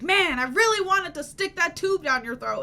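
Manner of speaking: gleeful letdown, mock eager; mildly hammed-up eager doctor; excited doctor. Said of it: "he's like".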